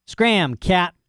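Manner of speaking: a gruff dog telling off a cat; snapping at the cat. Acted.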